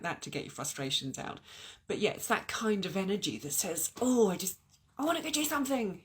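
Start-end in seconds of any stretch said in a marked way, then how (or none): 4.0-6.0 higher, louder, rushing eager burst; eager urge. Acted. Voicing the new moon energy to viewers, excitedly. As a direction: blurt it out like you can't sit still.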